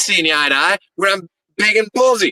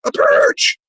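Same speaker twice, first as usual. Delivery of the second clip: high, straining wrestler's outcry; worked-up promo outcry. Acted. Wrestler recounting losing right after a hardcore purge, indignant high outcry. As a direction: cry out in high-pitched indignation, stretching the last word.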